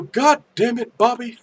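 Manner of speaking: mock-exasperated character voice; Hank Hill. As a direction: scold the boy in a put-on twang. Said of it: "Hank Hill".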